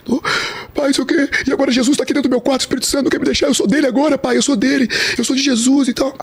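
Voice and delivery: high-pitched, overwrought pleading; insistent son. Retold or imitated. Son convincing father he is Jesus's now, insistent.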